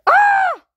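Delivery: short, high, loud cartoon scream. Matilda showing the birds her egg-laying, loud scream. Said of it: "I scream like".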